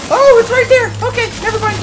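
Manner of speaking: high-pitched panicked yelp; sudden loud scared squeal; startled shriek. Real, not acted. Startled by the chainsaw.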